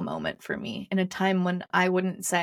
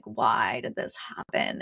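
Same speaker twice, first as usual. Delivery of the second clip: retold crying; 9 semitones lower, 3 dB quieter, slower than usual. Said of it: "I just remember crying and crying".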